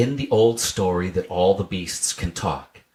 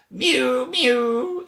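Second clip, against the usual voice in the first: high mewing cat. A narrator's reading. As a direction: mew like a cat at the door. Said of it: "interrupted Simpkin".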